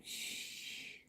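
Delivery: whispered shush, then a quiet exit; hushed whisper, barely voiced; hushing whisper. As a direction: shush under your breath and slip away. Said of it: "I'm like".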